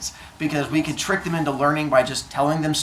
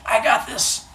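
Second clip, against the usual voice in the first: high-pitched mock confidence; self-assured runner; 7 semitones higher, slower than usual. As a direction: say it coolly, pitched up. Cocky runner at tryouts.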